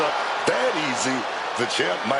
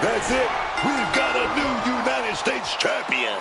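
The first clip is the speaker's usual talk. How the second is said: high, excited title call; excited commentator; 7 semitones higher than usual. Real, not acted. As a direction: call the new champion with excitement. Commentator calling title win.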